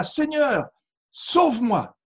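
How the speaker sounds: high-pitched cry for rescue. A narrator's reading. Sinking disciple begs Jesus to save him, crying out high. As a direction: call out urgently, pitch leaping up then falling. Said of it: "il s'écria".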